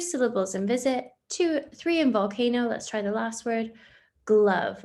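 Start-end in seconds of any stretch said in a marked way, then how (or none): 4.3-4.8 "glove" said louder as one beat; clapped syllable. Shown as a demonstration. Teacher claps "glove" as one beat for pupils. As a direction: clap "glove" once, firmly. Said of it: "clapping syllables for pupils".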